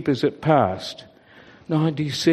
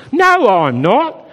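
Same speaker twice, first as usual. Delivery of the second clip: indignant denial; 7 semitones higher, 7 dB louder, slower than usual. A narrator's reading. Sheep quarrel over room.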